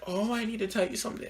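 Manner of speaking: high-pitched put-on girl's voice; imitated girl confessing. Girl confessing to her date in the car, high imitation.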